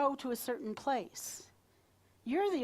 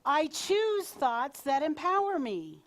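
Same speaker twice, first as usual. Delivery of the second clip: "choose" raised and drawn out; slow, louder declaration punching "choose"; stress on "choose"; 4 semitones higher, 5 dB louder than usual. Shown as a demonstration. Leader has the group repeat after her, stress on "choose". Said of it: "emphasis on different words".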